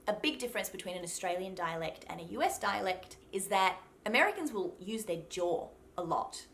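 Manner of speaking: normal talking voice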